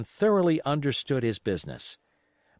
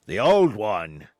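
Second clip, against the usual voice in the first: much louder, slow shout; cried out; 2 semitones lower, 7 dB louder, slower than usual. Acted. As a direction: shout the request from the crowd. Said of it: "cried another".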